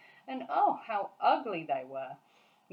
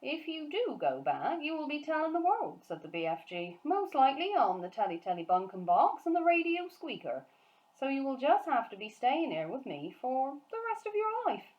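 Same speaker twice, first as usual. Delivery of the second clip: higher, louder, animated giant; BFG's voice; 7 semitones higher, 3 dB louder than usual. Acted. Giant warning Sophie about giant hunts, animated giant voice. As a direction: rattle on like the excited friendly giant.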